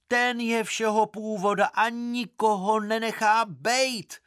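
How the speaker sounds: high-pitched, carrying scolding that everyone must hear. A narrator's reading. Maharani berates the brawling crowd in a high, loud voice. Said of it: "pravila vysokým hlasem, že to musil každý slyšet".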